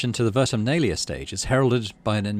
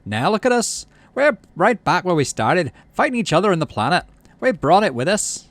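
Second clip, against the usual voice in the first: loud, much higher despairing rant; angry shout; 8 semitones higher, 6 dB louder than usual. Acted. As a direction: rail against the colony's fighting. Dad keeps shouting at the governor.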